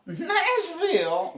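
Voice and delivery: high, slow drawn-out squeal with a laugh; mocking echo. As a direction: teasingly stretch the word way up high. Mimicking co-host's drawn-out Nashville.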